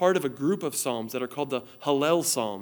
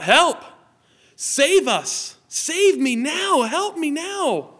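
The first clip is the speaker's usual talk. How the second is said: much higher and louder, slow drawn-out cries; desperate shout for help; 13 semitones higher, 7 dB louder, much slower than usual. Shown as a demonstration. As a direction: yell it like you're drowning. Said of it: "shout it out from your living rooms".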